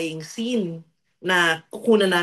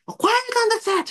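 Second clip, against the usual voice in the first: bossy director call; 13 semitones higher than usual. Pretend. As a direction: play the strict director calling the set to order. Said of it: "acting-acting ako para ano, mapaarte ko". (mahigpit na direktor na sumisigaw)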